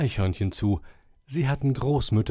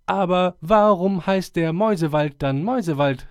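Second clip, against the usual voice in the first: called out; 8 semitones higher, 6 dB louder than usual. Acted. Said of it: "riefen ein paar Eichhörnchen".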